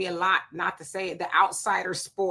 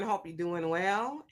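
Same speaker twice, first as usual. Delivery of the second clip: ordinary talk, nothing marked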